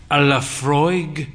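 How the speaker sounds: slow, savouring the order; a hint of a fey old gentleman ordering; lightly voiced man. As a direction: roll the name out leisurely. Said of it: "he said".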